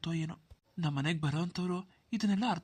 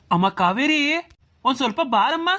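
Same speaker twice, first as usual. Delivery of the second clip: loud call with a rising, higher end; 8 semitones higher, 13 dB louder than usual. Acted. Brother calling his sister to come over, calling out. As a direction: call her over from a distance.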